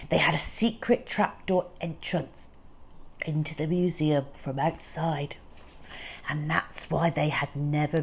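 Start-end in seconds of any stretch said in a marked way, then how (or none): none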